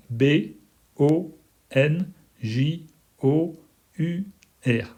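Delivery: slow, one letter at a time with pauses; spelling out letter by letter. Shown as a demonstration. Teacher spelling bonjour slowly for learners. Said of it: "ça va être".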